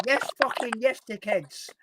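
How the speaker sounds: high Hull catchphrase; Hull accent character. Acted. Character cutting in after a glitch, high Hull accent. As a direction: burst in as a put-on Hull lad. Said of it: "taken the accent and made my own character".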